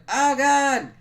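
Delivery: loud, high comic alarm; mock panicked cry. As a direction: yelp it in hammed-up panic. Voices victim clamped by arms.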